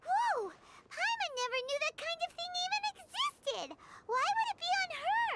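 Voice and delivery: high, excited amazement; astonished and a little alarmed; amazed. Acted. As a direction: gasp at something new. Amazed at the tracking device.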